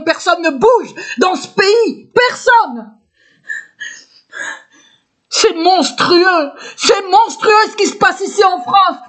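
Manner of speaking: high, drawn-out indignant outcry; slow, high-pitched cry of indignation; real outrage. Real, not acted. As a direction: stretch the words out in outraged protest. Outraged outcry that nobody acts.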